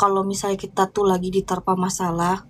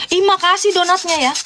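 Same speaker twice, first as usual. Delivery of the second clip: sarcastic thanks; 7 semitones higher than usual. Retold or imitated. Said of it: "Aku katain kayak gitu".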